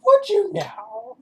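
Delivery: hammed-up sergeant major taunt; sergeant major sneer. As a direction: drag it out as a scornful sergeant. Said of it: "Sergeant Major".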